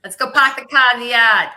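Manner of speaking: put-on loud, playful Boston accent. Calls to Rachel in jest, Boston accent. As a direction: belt it out like a Bostonian. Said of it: "that's pretty Yankee North".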